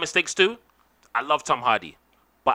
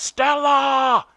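mock yelled STELLA, pitched up high; imitating Brando yelling Stella, pitched way up; Brando shout; 9 semitones higher, 3 dB louder than usual. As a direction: belt the name like Brando calling up the stairs. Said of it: "he shouted out".

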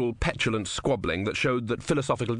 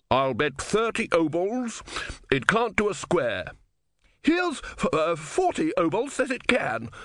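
slow, emphatic bets, the second starting very high; high, deliberate wagering; rival bettors; 7 semitones higher, slower than usual. Acted. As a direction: raise the stakes with relish.

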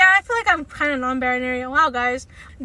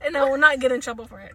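normal talking voice

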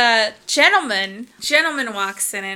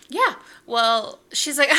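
usual speaking voice

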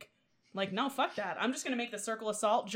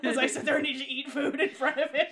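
laughing; 4 semitones higher, 6 dB louder than usual. Real, not acted. Taunting demon, laughing.

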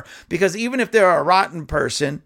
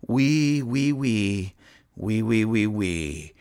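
mock violin; 6 semitones lower, much slower than usual. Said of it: "let me go find my violin and play a sad song".

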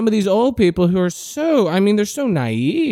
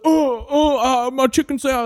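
put-on high, whimpering old man after a slap; hammed-up pathetic sob; mocking the slapped old man with a high, whimpering cry; whiny mock cry; 8 semitones higher than usual. He's like.

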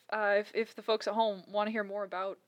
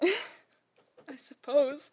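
drawn out through laughter; laughing; 5 semitones higher, much slower than usual. Real, not acted. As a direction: let the words sink into a laugh.

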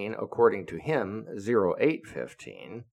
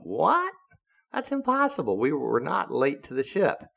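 mock-shocked squeak, much higher; retold disbelief; 6 semitones higher than usual. Sailors answering captain's charge, shocked disbelief. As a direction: pitch up in stunned disbelief. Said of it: "And we all went".

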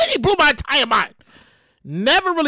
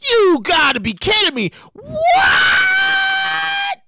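shocked disbelief; 14 semitones higher, much slower than usual. Retold or imitated. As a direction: react in total disbelief. Shocked reaction.

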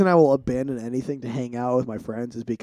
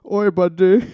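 mock Indian accent; 6 semitones higher, 8 dB louder than usual. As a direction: call out in a hammed-up Indian accent. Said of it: "You going Indian?".

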